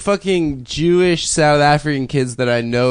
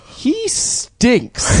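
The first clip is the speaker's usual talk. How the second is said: put-on higher kid blurting to grandma; blunt child voice; 10 semitones higher than usual. Boy tells his grandma about a passerby, high child voice. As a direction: say it like a clueless child. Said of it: "being like".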